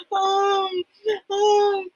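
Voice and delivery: high mock sobbing through the words; fake crying. Mother fakes crying to stop her daughter's tears, mock sobbing. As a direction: pretend to cry like a hurt child. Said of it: "fake crying".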